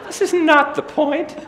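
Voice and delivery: high, loud, laughing protest to mom. Retold or imitated. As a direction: plead through laughter. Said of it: "And I'm like".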